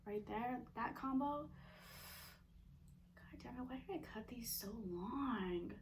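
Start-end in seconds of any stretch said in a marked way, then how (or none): 3.1-5.7 whispering. Real, not acted. Struggling with long food.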